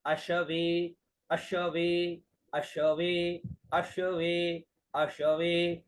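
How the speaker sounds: अशवे said slowly, each syllable clear; pronunciation demo. Shown as a demonstration. Teacher modelling अशवे for students, slow and clear. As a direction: spell out अशवे for the learner. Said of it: "तो क्या उच्चारण हुआ?".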